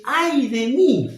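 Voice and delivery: high, loud, drawn-out wail of "Ay de mí"; slow anguished cry; prophet's lament. Acted. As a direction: cry it out slowly like a man undone. Prophet's lament quoted in sermon.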